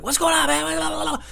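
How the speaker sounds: high put-on questioning; high mock voice. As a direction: chase him asking. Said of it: "I'm like".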